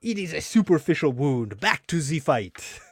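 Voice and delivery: slow, high French sergeant; French accent. Acted. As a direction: play the stern French sergeant. Acting out comic sergeant.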